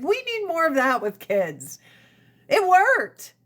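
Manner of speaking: ordinary delivery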